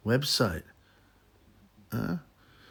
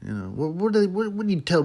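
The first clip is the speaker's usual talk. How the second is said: normal talking voice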